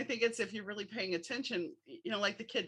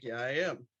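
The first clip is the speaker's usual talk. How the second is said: low voice posing as husband; deep-pitched, masquerading on the phone; 9 semitones lower than usual. Retold or imitated. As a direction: drop the pitch well down to pass as a man. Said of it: "I'm like".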